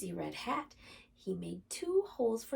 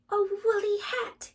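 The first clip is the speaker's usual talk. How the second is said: high squeaky joy; squeaky delight; 12 semitones higher, 9 dB louder than usual. A narrator's reading. Rabbit squeaking with delight over the hat. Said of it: "Rabbit squeaked with delight".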